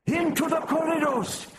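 put-on high-pitched old man's rallying cry; rally the troops in a high, strained voice. A narrator's reading. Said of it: "Propilis cried".

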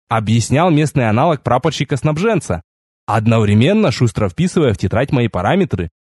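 usual speaking voice

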